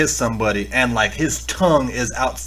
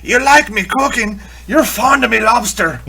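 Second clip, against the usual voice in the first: higher-pitched, loud mock sailor voice; old sailor quarrel; 10 semitones higher, 4 dB louder than usual. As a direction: play an indignant old salt, hammed up. Quotes the film's quarrelling sailor.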